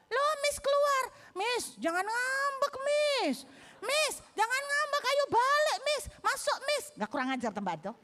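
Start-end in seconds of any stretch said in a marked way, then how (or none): 0.1-6.8 high, whiny mock pleading; pleading pupil. Pupil calls after teacher who walked out, pleading. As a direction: imitate a child calling the teacher back.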